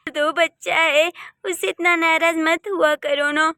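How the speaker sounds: ordinary talk, nothing marked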